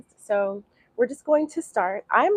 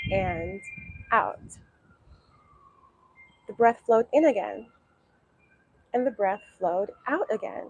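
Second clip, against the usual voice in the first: guided meditation. Acted. Teacher guiding beginners through breathing, calm meditation voice. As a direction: ease them into watching the breath.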